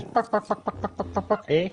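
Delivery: high mock chicken clucks, "buck, buck"; clucking chicken. Streamer mimics a chicken for his gaming friend, high mock clucks. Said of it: "French Canadian chickens".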